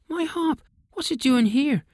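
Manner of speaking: very high, startled little elf voice; surprised wee elf. Acted. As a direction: squeak it out, amazed to see his harp. Elf king finds his harp.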